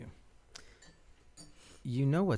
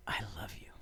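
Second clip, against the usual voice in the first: softer, fading to a whisper; whispered I love you; 3 semitones lower, 9 dB quieter, much faster than usual. Acted. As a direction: let the last one become a whisper. Repeated declaration of love.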